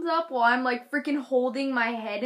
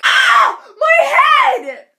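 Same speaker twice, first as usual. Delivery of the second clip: hammed-up howl of pain; mock agonized scream; 14 semitones higher, 16 dB louder, slower than usual. Hurt girl among idle onlookers, exaggerated scream. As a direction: shriek it, very loud and high. Screaming over her head injury.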